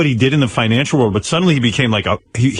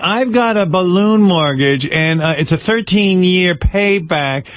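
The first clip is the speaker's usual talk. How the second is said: mock radio caller, high and slow; caller on a finance show; 6 semitones higher, slower than usual. Comic imitating a caller asking the radio host about a mortgage. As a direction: play a timid call-in listener. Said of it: "that's what it sounds like to me".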